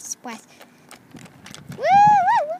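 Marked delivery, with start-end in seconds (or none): 1.8-2.6 high, louder woo cheer; squealing woo woo woo. Pretend. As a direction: cheer at the top of your voice. Doll cheering loudly.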